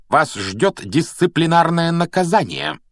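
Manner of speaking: high, slow, stern exclamation; indignant cry. A narrator's reading. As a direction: cry out a punishment, drawing the words out. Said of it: "вскричала она". (гневно вскричать высоким голосом)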